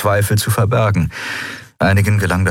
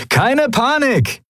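high, drawn-out jolly shout-out; cheerful call; much slower than usual. A narrator's reading. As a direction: call out in the best of spirits, very high and slow. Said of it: "rief Sephiron seinen Kumpanen in bester Laune zu".

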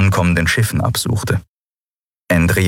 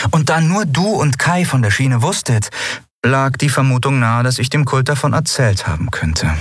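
lightly voiced young woman, higher and mocking; Lien, amused and scoffing; 7 semitones higher than usual. Lien mocks the crew's fear of the cult, amused and higher. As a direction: tease them for their fear, amused.

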